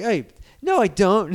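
put-on girly voice. Spider-Man insisting he doesn't sound like a girl, in a high mock girly voice. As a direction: squeak out the denial in a mock girl's voice. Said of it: "he sounded like a girl".

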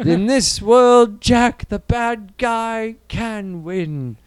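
high, loud, very slow hammed-up film quote; movie villain. Quoting Last Action Hero, slow theatrical villain. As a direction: declaim it word by word in a put-on voice. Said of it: "I was thinking of Last Action Hero".

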